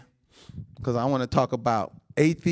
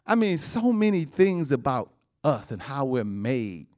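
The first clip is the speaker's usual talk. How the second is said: rousing preaching; 8 semitones higher than usual. Acted. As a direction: make them marvel at God's work. Preacher marvels at the body.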